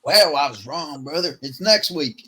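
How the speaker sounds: slow, put-on drawling fighter voice; a mock-earnest fighter shrugging off a failed doomsday, slowly; mock Bryce Mitchell. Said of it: "He's like".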